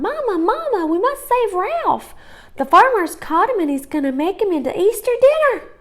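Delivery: put-on high, fast urgent little girl calling; calling urgently. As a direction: run in yelling for Mama.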